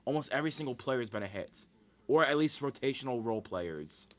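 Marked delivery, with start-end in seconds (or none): none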